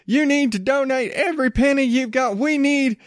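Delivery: high, hammed-up preacher pitch begging for money; mock televangelist. Comedian mocking a televangelist asking for church money, high hammed-up preacher voice. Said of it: "Is going out there saying".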